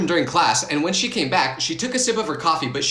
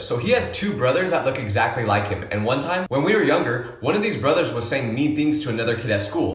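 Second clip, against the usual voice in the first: ordinary delivery, nothing marked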